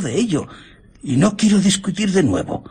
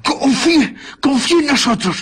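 anxious pleading; 6 semitones higher than usual. Acted. Pleading with captor.

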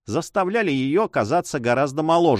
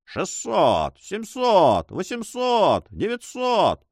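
gleeful auctioneer; 7 semitones higher, much slower than usual. A narrator's reading. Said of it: "потирал руки с весёлым видом".

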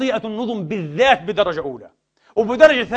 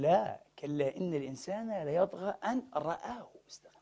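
a reverent whisper of scripture; whispered recitation; 3 semitones lower, 13 dB quieter than usual. Real, not acted. Preacher quoting the true Quran verse, reverent whisper.

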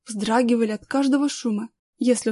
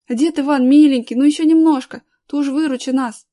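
loud, raised, eager pleading; impatient shouting; 3 semitones higher, 8 dB louder than usual. A narrator's reading. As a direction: beg the old man loudly to hurry. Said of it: "кричал Аркаша, подпрыгивая от нетерпения". (нетерпеливый громкий крик с мольбой)

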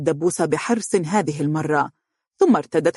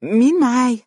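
higher put-on stranger voice; disguised voice; 7 semitones higher, 5 dB louder than usual. A narrator's reading. Wife disguising her voice to tease her husband, higher put-on voice. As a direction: fake a stranger's voice on the phone. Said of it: "حاولت أن تغير نبرة صوتها".

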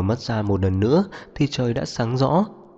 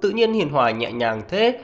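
high-pitched, indignant wife; angry tirade; 7 semitones higher than usual. A narrator's reading. Wife berating husband for softening, angry. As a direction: fire reproaches in a raised pitch. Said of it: "tức giận nã pháo về phía Tố Đông".

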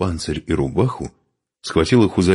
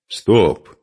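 loud, raised one-word order; commanding; 5 semitones higher, 6 dB louder than usual. A narrator's reading. Man stopping the woman's next blow, commanding. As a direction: halt them with a firm call. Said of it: "скомандовал Духарев".

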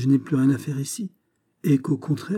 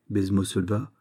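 usual speaking voice

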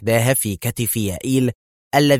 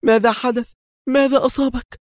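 much higher panicked questions; frightened woman's cry; alarmed cry; 14 semitones higher, 3 dB louder than usual. A narrator's reading. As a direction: rush to him in alarm. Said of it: "فأسرعت إليه هاتفة".

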